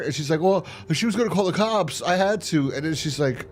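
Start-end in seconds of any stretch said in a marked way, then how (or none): 0.4-2.7 high, pleading self-defence; lightly mimicking a defensive friend. Sophia justifying herself to Deborah, high defensive voice.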